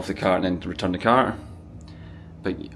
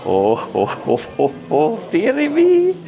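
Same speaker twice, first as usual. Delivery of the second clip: giddy delight, voice shooting up; excited squeals; much slower than usual. Real, not acted. Delighted at shop items.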